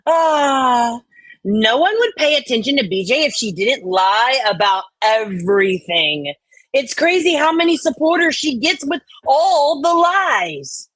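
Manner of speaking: exasperated chat read with a high, fed-up lilt; highly annoyed. Acted. Streamer voicing a chat comment as highly annoyed. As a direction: read it like you're sick of everyone's nonsense. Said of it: "I went with highly annoyed".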